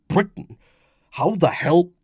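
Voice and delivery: high, loud, indignant outburst; angry surprise. Acted. Husband hears his secret named, startled and angry. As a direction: blurt it in startled anger. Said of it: "brow creased angrily".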